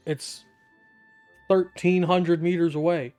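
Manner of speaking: usual speaking voice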